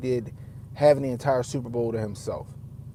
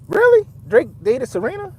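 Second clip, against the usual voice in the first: much higher, louder sudden disbelief; surprised; 12 semitones higher, 7 dB louder than usual. Real, not acted. Reacting to the news about Serena, real surprise. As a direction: caught off guard, voice jumps up.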